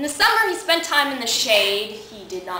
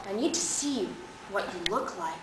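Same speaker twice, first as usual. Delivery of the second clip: soft, intimate request; hushed, earnest lover; gentle insistence; 4 semitones lower, 10 dB quieter, faster than usual. Acted. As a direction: say it quietly to a nervous lover. Bob's tender insistence, acted.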